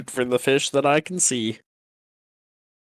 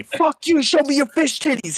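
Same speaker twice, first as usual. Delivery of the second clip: raging yell; 14 semitones higher than usual. Pretend. Fighter enraged at the arrow, yelling. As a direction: scream it in a rage. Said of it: "pick up the arrow in a rage".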